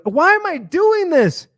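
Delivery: high, drawn-out exasperated question; acted self-questioning. Acts questioning himself.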